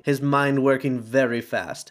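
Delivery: ordinary delivery, nothing marked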